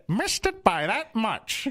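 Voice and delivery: high, very slow put-on TV spy voice; Maxwell Smart. Imitating Maxwell Smart's catchphrase, high and slow. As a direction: drag it out like a bumbling agent. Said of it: "it just turned into Maxwell Smart".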